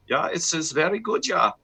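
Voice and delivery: a quick sample of a German accent. Shown as a demonstration. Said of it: "German being a very common one".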